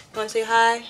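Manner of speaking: sweet voice for the dog; talking to a pet. Real, not acted. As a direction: coo at the dog.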